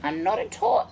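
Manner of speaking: very high-pitched telling-off of a pet; squealed protest. Real, not acted. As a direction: protest shrilly to the playful attacker.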